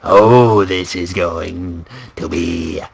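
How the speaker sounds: low, slow, drawn-out villain voice; slow and low, savouring each phrase with sudden swells; creepy theatrical villain; menacing clownish villain. Acted. Performs an unnamed villain impression.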